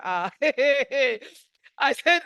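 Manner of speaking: a slow laugh before going on. Real, not acted. Telling her aunt's story, laughing. Laughing while retelling a talk.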